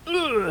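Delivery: high strained grunt of pulling; imitating a heavy-lifting grunt; hammed-up strain; mock effort grunt. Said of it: "a big grunt out of Dave Murphy".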